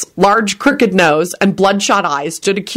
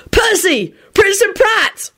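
high, hearty cheer; bright high exclamation; cheerful; 14 semitones higher, slower than usual. A narrator's reading. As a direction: call out happily to an old pal. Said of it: "William replied cheerfully".

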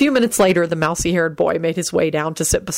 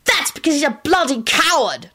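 high, indignant outburst; angry shout; 10 semitones higher than usual. A narrator's reading. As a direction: hurl the accusation. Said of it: "Timothy shouted".

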